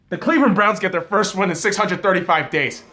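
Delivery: loud, high, fast burst of big news; excited announcement. Real, not acted. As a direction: blurt out the huge news loudly.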